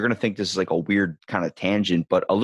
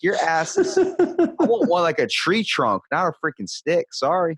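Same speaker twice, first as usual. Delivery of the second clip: mock rant; 7 semitones higher than usual. Mocking rant at the girl.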